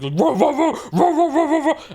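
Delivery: loud, high volley of barks; imitated dog barking. Retelling the pit bull barking at Gatsby, loud mock barking. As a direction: bark like an aggressive dog. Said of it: "it is already barking at Gatsby".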